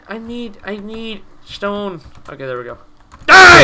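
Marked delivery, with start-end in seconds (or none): 3.3-3.6 high, very loud shout; battle yell. Real, not acted. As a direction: yell it at the enemy. Fighting a monster in the game.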